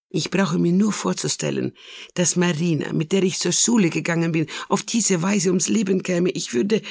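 rising upset, groping for words; lightly voiced Italian mama, wound up; Mama Carlotta, agitated.

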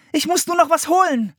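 very high, hurried-sounding call. A narrator's reading. Anne explaining she must fetch something, calling out. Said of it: "rief Anne".

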